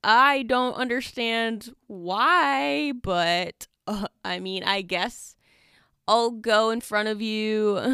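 high, drawn-out "okay" easing into hesitant agreement; mock puzzlement, dragged out; acted reluctant acceptance. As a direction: play up baffled, grudging acceptance. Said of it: "I'm over here like".

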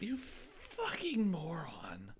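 slow, high whispered insult; whispering. Pretend. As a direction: hiss the insult slowly.

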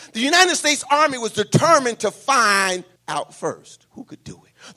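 ordinary talk

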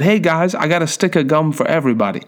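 put-on high, quick kid pitching a distraction; acted eager schoolboy announcement; eager offer. Acted boy deflecting blame with gum.

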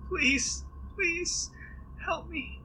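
very high, far-off begging voice; a thin high woman's plea; Tilda pleading. Acted. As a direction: plead high and small for help. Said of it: "She says".

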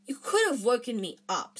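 a touch of grumpy protest; cross complaint. A narrator's reading. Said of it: "said Ron crossly".

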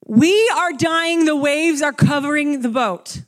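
loud, slow, drawn-out desperate wail; bigger panic. Retold or imitated. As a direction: go all in on the drowning terror. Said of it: "You guys aren't reacting the way that I feel is fitting".

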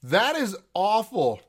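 pained from spicy heat. Real, not acted. Reacting to hot sour candy.

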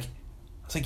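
ordinary talk

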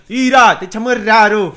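high, loud teasing yell; mock shout. Teasing his cohost about the word, loud mock shout. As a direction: blurt it out like a mocking call.

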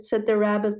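usual speaking voice

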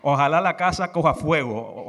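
ordinary delivery

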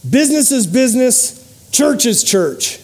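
stern and final, second half slow. Retold or imitated. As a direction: quote the client coldly. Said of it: "he very sternly said".

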